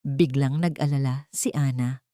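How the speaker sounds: normal talking voice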